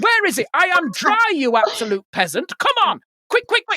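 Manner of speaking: hammed-up lady of the manor barking for service; spoiled posh demands. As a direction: lord it over a waiter. Mock-posh [name] bossing waiter.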